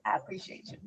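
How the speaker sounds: whispered. Real, not acted. Echoing guest's thanks softly.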